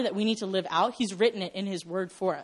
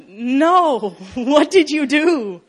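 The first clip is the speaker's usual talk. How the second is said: high, loud laughing dismay; mock dismay; 5 semitones higher, 9 dB louder, much slower than usual. Reader of the Old Testament, dismayed and laughing. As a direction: groan at Israel's mistake, laughing. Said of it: "all of a sudden you're like".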